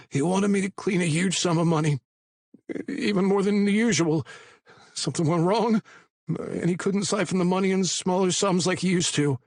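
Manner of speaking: high, anxious pleading voice; a scared informant talking fast; nervous young man. A narrator's reading. Patty voiced high and frightened.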